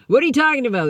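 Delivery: high, loud confused question; half-mocking bafflement; baffled. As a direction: sound thrown by the question. Baffled reply to sponsor.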